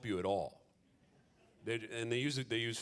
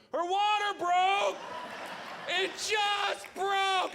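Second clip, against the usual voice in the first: much higher, louder, slow mock panic; panicked shout; 5 dB louder, much slower than usual. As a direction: yell it like an emergency.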